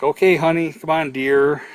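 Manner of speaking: sweet pet-name talk. Retold or imitated. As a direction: coo at an elderly patient like a grandchild. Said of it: "pet names where you're like".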